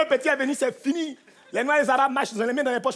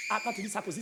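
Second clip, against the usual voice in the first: normal talking voice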